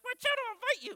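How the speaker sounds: very high puppet warning; puppet's snake answer. Pretend. Puppet answering what a snake says, high mock warning. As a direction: squeak a mock threat. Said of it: "A snake says".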